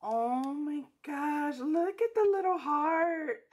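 high-pitched, emotional gushing; overjoyed squeal. Real, not acted. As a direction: squeal as if melting over something tiny. Delighted reaction to a detail.

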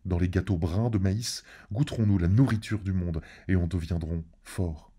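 ordinary delivery

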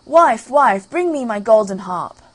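booming ogre call. Acted. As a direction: summon the wife across the room. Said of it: "the ogre called out".

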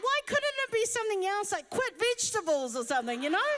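high, fast, laughing mock protest; laughing exasperation. Exasperated about quitting sugar.

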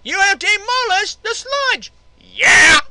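loud, high victory whoop; excited laughing cheer. Real, not acted. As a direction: celebrate the win loudly. Boss beaten, cheering.

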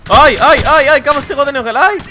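high, loud, fast oy-oys; frantic high yelps under fire; panicked yelping. Real, not acted. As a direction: cry out as the hits keep landing. Getting hit repeatedly in game.

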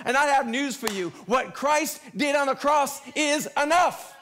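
high, driving pulpit voice; impassioned preaching. Real, not acted. Preacher telling guilty congregation the cross is enough, impassioned preaching. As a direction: preach it with rising force, landing each point.

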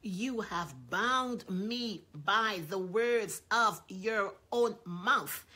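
slow and loud; solemn emphasis. Acted. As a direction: lay each word down heavily. Solemn reading of God's words.

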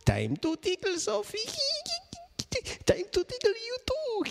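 very high, giggly tickle voice; playful tickle. Acted. Reader playfully tickling the listening children, very high. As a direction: tease them with a tickle.

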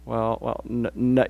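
low, soft, halting stammer; hesitant stutter. Retold or imitated. Church member asked about devotions, mock hesitant stutter. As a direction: stumble quietly over the first sounds, imitating someone unsure. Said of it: "kinda stutter a little bit".

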